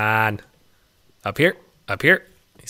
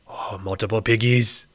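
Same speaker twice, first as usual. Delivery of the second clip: ordinary talk, nothing marked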